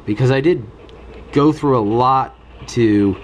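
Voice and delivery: ordinary talk, nothing marked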